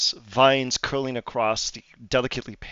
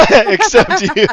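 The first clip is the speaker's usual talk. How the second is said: laughing; 12 semitones higher, 14 dB louder, slower than usual. Real, not acted. Teasing Hayward about vanishing.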